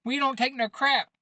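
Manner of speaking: playful tough-guy whoop; mock cheer. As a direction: chant it like a proud gang. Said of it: "everybody's like".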